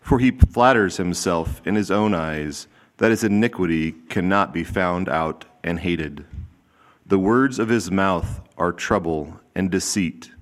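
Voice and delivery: ordinary talk, nothing marked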